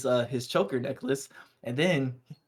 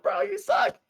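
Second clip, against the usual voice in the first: much higher, playful teasing squeak; mock squeaky jab; slower than usual. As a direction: toss it off in a high mock squeal. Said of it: "Bundus is like".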